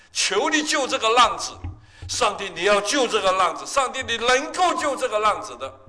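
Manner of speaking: loud, high pleading prayer to God; mother's desperate prayer, voiced by the preacher; imitating a crying-out prayer. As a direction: cry out to heaven for a lost son. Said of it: "她的祷告是呼天喊地".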